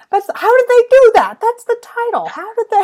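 high, loud amazed exclaiming. Real, not acted. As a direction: gasp out your wonder. Amazed by title effect.

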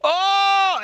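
very high, loud gasped oh; startled at the last moment; mock shocked conductor. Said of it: "the conductor's like".

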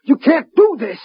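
high, desperate pleas; panicked pleading. Acted. Detective pleading with the Martians during the countdown, panicked. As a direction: beg for your life as the count runs.

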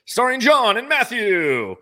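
slow, high announcer intro; radio voice. Acted. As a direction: boom it like a radio host. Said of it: "My radio voice".

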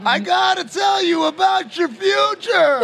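high, slow, eerie shouted prophecy; ominous voice of doom from afar; spooky fortune teller. Pretend. Mock spooky future-teller voice.

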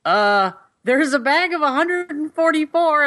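high, slow mock shout across a store; mild mock yell from across the shop; retold yell. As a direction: call it out high and drawn out like a clerk far away. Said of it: "he yells".

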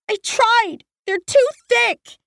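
put-on high, tearful child's complaint; despairing girl. A narrator's reading. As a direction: say it near tears, giving up.

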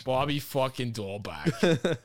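slow, drawn-out Boston Bobby fucking Dahlback; Bobby fucking Dahlback, broad Boston vowels; Boston accent. Shown as a demonstration. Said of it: "You gotta see the fuck in between it".

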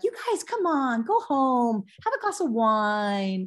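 high, drawn-out exasperated appeal; imagined mother's plea. Retold or imitated. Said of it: "she probably would be like".

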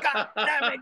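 high-pitched mock cursing; retold cursing. Goulet breaking his own rule on bad words, mock cursing. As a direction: imitate an outburst of swearing, pitched high. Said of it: "He started cursing".

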